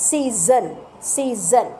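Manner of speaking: সিজন with a buzzing z in the middle, see-zn; teacher repeating সিজন slowly; clear word demo. Shown as a demonstration. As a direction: say সিজন with a voiced z. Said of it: "বোঝা গেছে".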